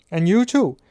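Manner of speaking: loud, high stern order. A narrator's reading. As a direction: round on them too. Glaring at Ron and Fred.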